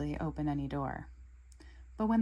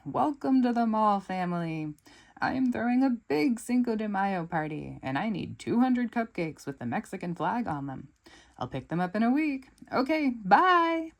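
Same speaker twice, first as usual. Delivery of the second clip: Eduardo greeting; 4 semitones higher, 4 dB louder than usual. Acted. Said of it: "he says".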